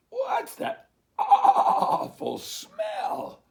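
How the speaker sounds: high, very slow, loud laughing bleat; bleating sheep. Acted. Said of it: "they bleated".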